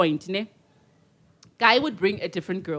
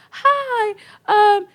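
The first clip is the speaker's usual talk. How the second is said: very high-pitched hi; awkward greeting; 12 semitones higher, 5 dB louder than usual. Retold or imitated. Her greeting the man who hailed her, high and awkward. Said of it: "I didn't know how to behave".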